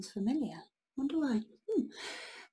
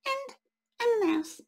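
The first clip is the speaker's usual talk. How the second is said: much higher squeaky mouse voice; tiny mouse voice; 12 semitones higher than usual. Acted. Mouse answering the troll in a tiny high voice. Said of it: "said the fairy creature".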